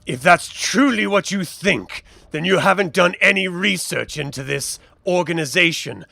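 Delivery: deliberate, emphatic villain monologue; menacing gang villain. Acted. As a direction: lean on key words to threaten.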